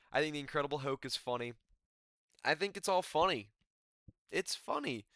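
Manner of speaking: ordinary delivery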